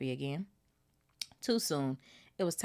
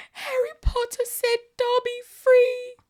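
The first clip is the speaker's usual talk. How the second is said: high, slow elf cry; shrill elf; 10 dB louder, slower than usual. Acted. Dobby repeating his thanks to Harry, high and slow. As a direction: draw out the joy, high. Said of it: "said the elf shrilly".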